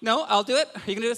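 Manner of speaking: ordinary delivery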